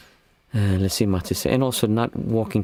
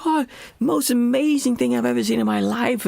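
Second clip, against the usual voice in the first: squealing, much higher falsetto gush of awe; over-the-top tourist excitement. Retold or imitated. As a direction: gush like a thrilled tourist who just saw a whale. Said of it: "he was completely over the top".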